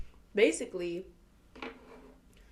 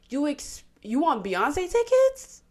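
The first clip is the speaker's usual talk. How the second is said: higher, louder, slow scoffing question; incredulous; 6 semitones higher, 8 dB louder, slower than usual. Real, not acted. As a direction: scoff at the nerve of it. Mocking the fiancée's demand.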